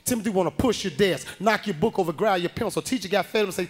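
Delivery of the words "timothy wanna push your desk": ordinary talk, nothing marked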